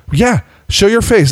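high-pitched taunting demand; mock confrontation. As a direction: imitate an accuser calling him out. Retold woman confronting him.

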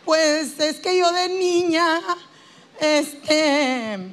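very slow, drawn-out whine; hammed-up therapy patient, haltingly dragging every phrase; self-pitying patient. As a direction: stretch the last vowels into a near sing-song moan. Acting the patient again.

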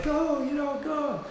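high, excited cheer of encouragement. Retold or imitated. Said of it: "if you encourage them".

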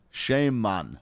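playful Jamaican impression; mock Jamaican accent. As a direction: call it out in a put-on Jamaican accent. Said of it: "do a Jamaican voice".

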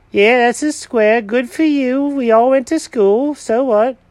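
high, mocking cat voice; cat mocking the builder about the square, high pitched; sarcastic cat. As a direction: brush him off sarcastically. Jenkins sarcastic in voice.

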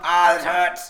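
loud hammed-up cry of pain. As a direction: yelp it out like a hurt creature. Game master voices punched bird creature.

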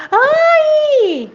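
very high, loud echoing yell; echoed cry. A narrator's reading. Mountain voice answering the boy, high echoing cry. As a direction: throw the cry back from the mountain. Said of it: "ele escutou uma voz".

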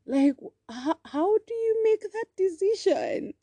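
incredulous. Real, not acted. Mother baffled by her son's choice, high incredulous. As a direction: ask it in high, stunned disbelief.

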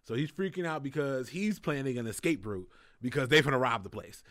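ordinary talk

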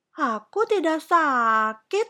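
high, drawn-out denial; protesting child. A narrator's reading. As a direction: object stubbornly like a little princess. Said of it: "bantah Putri Kecil".

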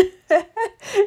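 high laugh with a crying edge; half laugh, half cry. Real, not acted. Laughing at the furniture she could have moved, crying edge.

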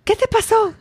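high-pitched retold "what happened"; imitating alarmed mom. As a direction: play a startled Mexican mom. Mom comes out, reacting to injury.

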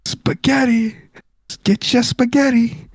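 high-pitched mock hawker's cry; cartoonish food-seller call; imitating a yelling vendor. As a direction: sing out your wares to passers-by without shouting.

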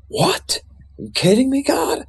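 high, startled pitch jump in disbelief; recoiling at a wild idea, voice shooting up; freaked-out disbelief. Retold or imitated. Man retelling answering God's call, high startled disbelief. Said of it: "I was kind of freaked out".

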